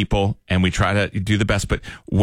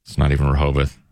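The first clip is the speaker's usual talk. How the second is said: low, joyless admission; glum caller; 7 semitones lower than usual. Acted. Caller explaining an obligation trip to hosts, glum. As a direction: admit it low, resigned to the trip.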